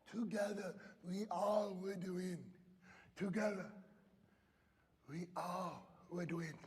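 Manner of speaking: soft, slow lightly voiced girl; tender girl's voice. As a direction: say it gently like a proud child. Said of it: "And she goes".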